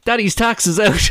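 put-on high, bright child's announcement; child piping up. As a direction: pipe up like a cheeky toddler. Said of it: "The child piped up".

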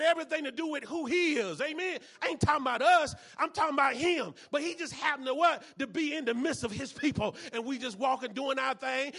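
loud, driving sermon delivery; rising fervour in the preaching; preaching at full force. Real, not acted. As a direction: preach it hard to the congregation.

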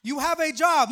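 preacher raising his voice on the repeat. Real, not acted. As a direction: hit it harder the second time to the congregation, loud and emphatic. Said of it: "repeated for emphasis".

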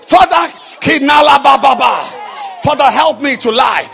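loud, high, chanting sermon cadence; fiery preaching. Acted. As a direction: drive the point home to the crowd. Preaching at full force.

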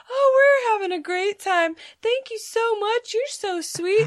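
high sugary overly nice voice; fake sweet customers. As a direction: chirp it like a fake-friendly customer. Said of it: "they were just like".

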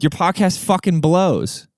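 normal talking voice

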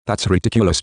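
ordinary delivery

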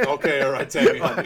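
calm her down loudly through a laugh; loud laughing placate. Retold or imitated. Man soothing angry Tammy, loud laughing placate.

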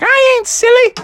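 high, defiant falsetto mother; squeaky mom boasting; Froggy's mom. Acted. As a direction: declare it proudly, stretching the end. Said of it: "she's like".

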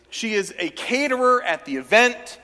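normal talking voice

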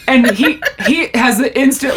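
ordinary delivery

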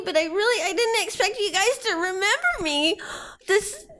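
high, chirpy small creature; little rabbit voice. Acted. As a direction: play a sweet murder bunny. Biscuit thrilled and touched.

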